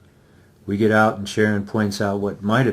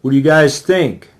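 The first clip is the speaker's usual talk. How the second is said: loud, higher call across the yard; 5 semitones higher, 8 dB louder than usual. Acted. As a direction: call the question over to the group. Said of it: "Chance calls from the path".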